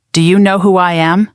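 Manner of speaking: usual speaking voice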